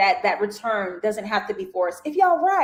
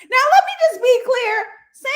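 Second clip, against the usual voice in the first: high emphatic; 14 semitones higher, 7 dB louder than usual. Real, not acted. Emphatic opinion to viewers.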